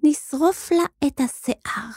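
high, slow, deliberate threat; menacing girl. A narrator's reading. Girl proposes a cruel punishment. (איום איטי בקול גבוה של ילדה)

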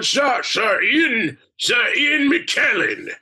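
high, slow, ringing theatrical knight voice; Ian McKellen. Retold or imitated. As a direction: announce yourself like a grand old stage actor. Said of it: "how he speaks".

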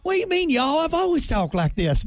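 high mock Southern accent; protesting in a hammed-up Southern accent. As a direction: put on an indignant Southern twang. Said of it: "came back with a Southern accent".

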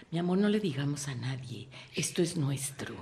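secretive sweet talk. Retold or imitated. Speaker voicing a partner hiding the relationship, softly coaxing. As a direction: hush it like a secret lover. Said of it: "mantener tu relación en clandestinidad".

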